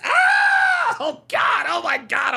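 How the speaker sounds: shrill scream into laughs; shrieking jackpot winner. Real, not acted.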